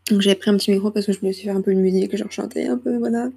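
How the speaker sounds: normal talking voice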